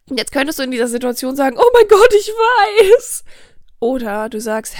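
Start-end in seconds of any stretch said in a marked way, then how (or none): 1.6-3.2 shrill, much higher and much louder panic; panicked reply. Acted. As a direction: squeal it out like you are losing it. Panische Antwort auf die Chefin. (hysterisch, viel höher und viel lauter)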